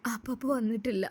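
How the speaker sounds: put-on hushed whisper to a child; soft unvoiced whisper, hiding bad news. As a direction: breathe it so the little one barely hears. Mother hushing child about father.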